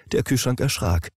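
ordinary delivery, nothing marked

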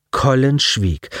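plain reading voice

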